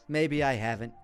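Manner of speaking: ordinary talk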